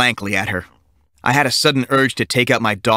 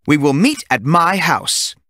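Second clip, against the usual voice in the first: slow stern teacher; 5 semitones higher, slower than usual. A narrator's reading. Teacher slow firm order.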